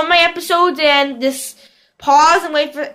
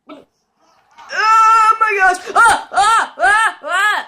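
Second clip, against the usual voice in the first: high, drawn-out yell, then short cries; excited screaming; 6 semitones higher, much slower than usual. Real, not acted. Player reacts to the match, high excited screams. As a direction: scream in disbelief, then burst out in yelps.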